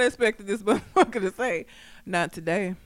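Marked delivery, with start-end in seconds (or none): 2.1-2.8 lower, softer retold straight answer; deadpan. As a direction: say it with no emotion at all. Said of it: "said it with such a straight ass face".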